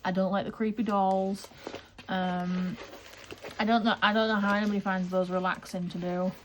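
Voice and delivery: ordinary delivery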